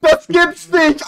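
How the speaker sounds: slow, drawn-out and high; stunned disbelief. Real, not acted. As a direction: stretch it out in shock. Stunned by the final result.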